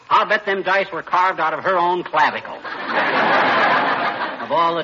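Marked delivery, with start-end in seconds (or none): 2.6-4.4 wheezy unvoiced laugh at his own joke; breathless gleeful laughing; big laugh. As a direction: laugh it off hard.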